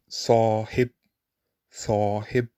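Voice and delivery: sahib with a heavy, dark emphatic S. Shown as a demonstration. Teacher modelling emphatic S in sahib. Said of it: "the last pair of emphatics".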